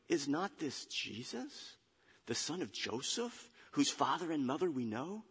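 soft, doubtful murmur, rising at each phrase; grumbled questioning. Acted. Crowd asks who Jesus is, grumbling softly. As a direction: question it under your breath. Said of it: "now we know what they were saying".

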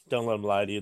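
dropped low and soft; low quiet aside. Pretend. Repeated line, low quiet aside.

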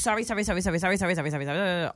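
rattled-off sorries, sliding down in pitch; repeated apology. Retold or imitated. Mimicking the dude apologizing a thousand times, rattled off. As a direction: run the apologies together like a chant. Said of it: "thousand times".

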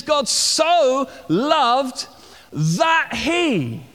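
"so" stretched long and high, "that he" set apart; emphatic "so". Shown as a demonstration. Preacher quoting scripture to show what Jesus is emphasizing, stress on "so". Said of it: "Jesus is emphasizing the fact".

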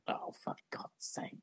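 exasperated whisper. Real, not acted. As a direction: whisper it in defeat. Stumped by quiz question.

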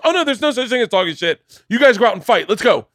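high, loud urging; eager goading. Retold or imitated. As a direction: push them out the door. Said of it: "And he goes".

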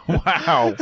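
ordinary delivery

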